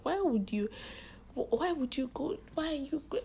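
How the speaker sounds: high-pitched, stammered protest; disapproving mom. Retold or imitated. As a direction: imitate a mother objecting, pitch climbing. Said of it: "She wasn't having it at all".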